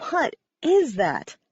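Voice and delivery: plain reading voice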